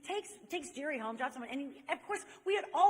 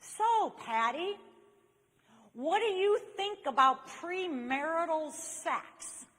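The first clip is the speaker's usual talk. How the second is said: slow, loud, higher awkward-guy voice; Jerry; 6 semitones higher, 6 dB louder, slower than usual. Retold or imitated. Awkward friend asks a question over drinks, slow loud imitation. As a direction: mimic him laboring slowly over each word. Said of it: "Jerry talks like this".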